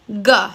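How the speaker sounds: G said as a hard guh; letter sound G. Shown as a demonstration. Teacher showing children the spelling's sound, G as guh. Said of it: "The G sound".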